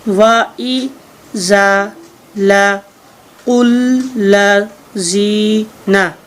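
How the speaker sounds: वा ई जा ला कुल ला जी ना split into slow separate syllables; syllable-by-syllable drill; sounding out syllables. Shown as a demonstration. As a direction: sound out वा, ई, जा, ला, कुल one by one for the class. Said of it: "तो इनके sound हमने निकालने हैं।".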